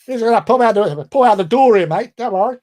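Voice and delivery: high, loud Australian-accent imitation; mock Australian. Mimicking the Australian chap at the plane door, mock Australian. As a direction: play the Australian instructor egging him on. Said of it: "attached to this Australian chap".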